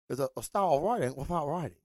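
quick, lightly put-on Bruce Lee accent; a hint of Bruce Lee, fast and soft; Bruce Lee impression. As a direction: rattle it off in a mock Bruce Lee voice. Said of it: "that's my Bruce Lee impersonation".

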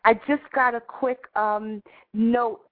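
bright, high radio host greeting; morning show host. Acted. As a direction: welcome listeners like a radio host.

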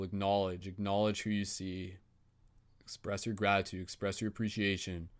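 soft, even meditation voice; guided meditation. Acted. Facilitator guiding the group circle in calm meditation voice. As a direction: gently guide the circle inward.

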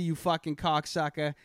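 played-up Boston accent; exaggerated Boston accent. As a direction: finish the insult in thick Boston. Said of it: "played up my Boston accent".

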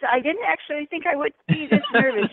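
blurting out nerves, quick and loud; stage fright. Real, not acted. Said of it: "I am really nervous".

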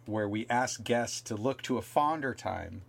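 ordinary talk

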